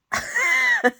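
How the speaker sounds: loud, high-pitched burst of laughter. Real, not acted. Amused by the dog story.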